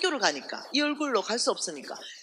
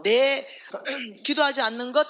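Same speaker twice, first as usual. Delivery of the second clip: louder and higher, driving the point; emphatic preaching; 4 semitones higher, 5 dB louder than usual. Acted. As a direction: hammer the point home to the congregation. (크게 외치듯 강조하는 설교)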